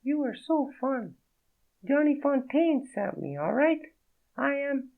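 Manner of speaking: a startled French woman, voiced high; French accent. Naked woman startled by Fredo, in a high French accent. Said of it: "The accent was real".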